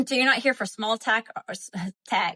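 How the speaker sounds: ordinary delivery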